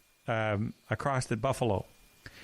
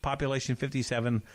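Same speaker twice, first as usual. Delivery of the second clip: ordinary talk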